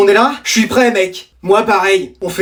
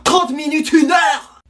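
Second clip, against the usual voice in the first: shouted back, higher, in disbelief; outraged mother repeating; 5 semitones higher, much slower than usual. Acted. Mother echoes her son's game time, shouting in outrage. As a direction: throw his words back at him in a shout.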